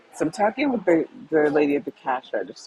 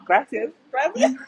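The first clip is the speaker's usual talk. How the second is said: dismissive thanks; 10 semitones higher, 4 dB louder than usual. Retold or imitated. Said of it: "my way of saying".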